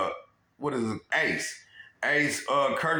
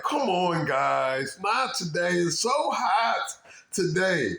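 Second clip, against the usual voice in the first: higher, slow, drawn-out plea; tired office lady begging them to stop, higher and slow; lady boss pleading, higher and slowed; weary lady boss; 6 semitones higher, slower than usual. Retold or imitated. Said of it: "She like".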